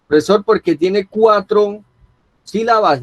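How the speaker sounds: lightly voiced student asking; student's question. Teacher mimics a student guessing why it is esdrújula, lightly voiced questioning student.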